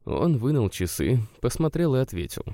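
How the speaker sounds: plain reading voice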